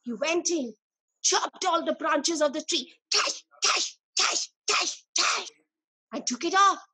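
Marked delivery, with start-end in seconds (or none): 3.1-5.5 chopping sound. Pretend. Chopping branches sound effect.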